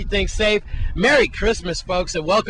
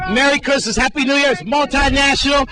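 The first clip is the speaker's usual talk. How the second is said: loud, upbeat TV reporter wrap-up; reporter sign-off; 4 semitones higher, 6 dB louder than usual. Acted. Reporter hands back to Jerome, loud upbeat sign-off. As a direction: wrap the segment with big on-air energy.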